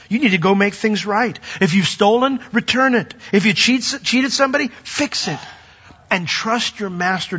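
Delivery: urgent preaching. Acted. Pastor urging the crowd.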